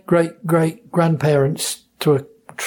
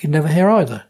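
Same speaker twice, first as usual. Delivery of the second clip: ordinary talk, nothing marked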